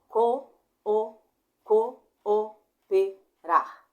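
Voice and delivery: "co, o, pe, rar" em golpes fortes e separados. Shown as a demonstration. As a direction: solte cada sílaba de "co, o, pe, rar" num jato alto, com pausa. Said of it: "um golpe de ar". ("co, o, pe, rar" escandido com força, sílaba por sílaba)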